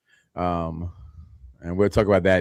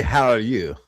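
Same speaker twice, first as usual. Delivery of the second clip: a quick, lightly put-on British accent; a half-hearted British accent tried for a joke; slower than usual. Cohost trying a British accent for the host. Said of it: "was that your British accent?".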